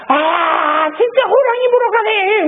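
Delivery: put-on high, wailing old-lady lament; imitating a deaconess. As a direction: whine it like an old church lady. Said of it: "우리 권사님 맨날, 하듯이". (권사님 흉내로 높게 한탄하며)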